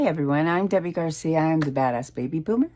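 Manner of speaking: ordinary talk, nothing marked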